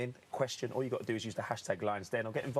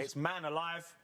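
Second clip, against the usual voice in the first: higher, light bingo caller call; 5 semitones higher, slower than usual. Acted. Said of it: "in my best bingo caller impression".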